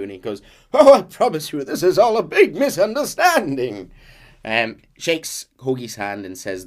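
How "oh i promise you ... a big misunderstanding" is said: high, loud theatrical protest; hammed-up plea in a raised voice; protesting innocence. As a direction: play a showman insisting on his innocence. Acting out Trelawney pleading innocence.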